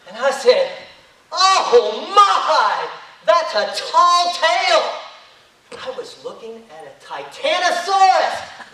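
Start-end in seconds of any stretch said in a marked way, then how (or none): 1.3-5.0 slow, drawn-out gasp of wonder, louder and higher; awed exclamation. Acted. Storyteller gazing up at a dinosaur, slow and awed. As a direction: marvel out loud at the giant.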